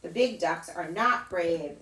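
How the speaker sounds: ordinary delivery, nothing marked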